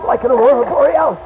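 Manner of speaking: overwhelmed by the blinding glare; awed terror. Acted. Blinded pilot crying out to ground control, high and fearful.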